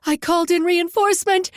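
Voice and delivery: high, loud defiant yell; scream. A narrator's reading. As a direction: scream it across the yard. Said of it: "Kara screamed".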